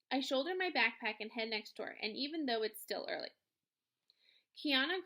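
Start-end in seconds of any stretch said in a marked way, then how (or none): none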